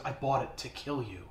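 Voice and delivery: low, soft dying reply; quiet confession. Retold or imitated. Dying kid confessing to Zhal, quiet confession. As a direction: let it drop low and soft.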